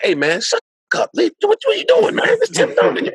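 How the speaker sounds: high, hushed panicky whisper; panicked teammates. Retold or imitated. Teammates hushing the ball boy, high frantic whisper. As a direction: hiss a frantic warning. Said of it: "And the rest of us are like".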